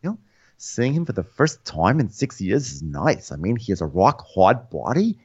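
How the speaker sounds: lilting caricature with swooping pitch; mock smitten confessional; Ramona. Impersonating Ramona on John.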